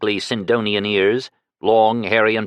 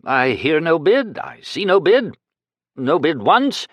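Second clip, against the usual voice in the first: higher, slow nervous call; 7 semitones higher than usual. A narrator's reading. Nervous slow auction call. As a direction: auctioneer nervously calling for bids. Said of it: "said nervously".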